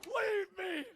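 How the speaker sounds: high shouting dramatic last stand; wounded soldier gasping. Pretend. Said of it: "I'm a lost cause".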